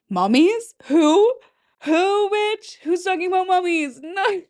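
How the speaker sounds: high-pitched mock disbelief at oneself; comic, incredulous squawk; Michael Scott. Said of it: "My Michael Scott?".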